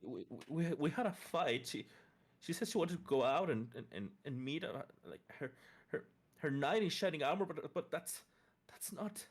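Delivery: a hint of a shaken mother; grieving mother.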